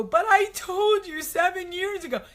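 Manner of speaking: high, whining protest; high whine of a frustrated wife; whiny wife. Retold or imitated. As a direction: plead like a hurt wife. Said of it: "and you go".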